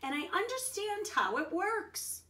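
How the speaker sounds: loud, high happy shout; boy shouting to grandpa in delight; excited shout. Acted. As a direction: burst in shouting with joy. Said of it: "he shouted".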